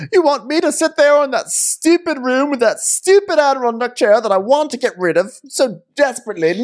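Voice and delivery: whiny husband voice. Retold or imitated. Corlys whining to his wife.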